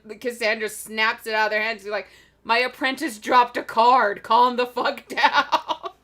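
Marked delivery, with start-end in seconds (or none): 2.4-5.9 louder retold scolding, stretched at the end; mock exasperation. Fortune teller calming panicked World Walkers, mock exasperated. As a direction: scold loudly, drawing out the last words into a laugh.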